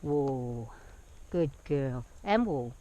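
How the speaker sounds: very low, soft steadying call; calm whoa. Real, not acted. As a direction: ease her to a stop, low and quiet. Steadying the horse to stand.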